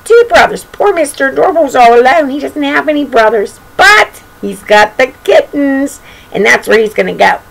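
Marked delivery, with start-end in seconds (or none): none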